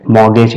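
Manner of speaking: "मॉर्गेज" said MOR-gej, with no t; silent T. Shown as a demonstration. Teacher telling class to mind pronunciation, "मॉर्गेज" with silent t. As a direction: drop the written t in "मॉर्गेज". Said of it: "लेकिन वो silent है".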